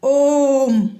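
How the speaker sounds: ओम, the vowel held long; drawn-out ओम. Shown as a demonstration. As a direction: stretch ओम as a calling vowel. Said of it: "जैसे".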